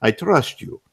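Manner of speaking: put-on Russian tough guy accent. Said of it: "he goes".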